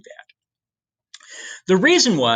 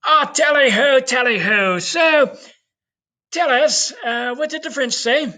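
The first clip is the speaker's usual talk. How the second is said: mock posh English; 10 semitones higher, 4 dB louder than usual. Teacher voicing the English court questioning Columbus, high mock British accent. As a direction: ham up an upper-class British twit. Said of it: "And they go".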